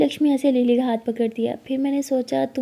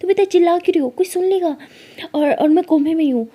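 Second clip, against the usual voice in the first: high, loud, fast scolding; urgent scolding; 6 semitones higher, 4 dB louder than usual. Retold or imitated. Lily telling Lakshmi to stop shouting, urgent loud scolding. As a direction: scold her to shut up, urgently.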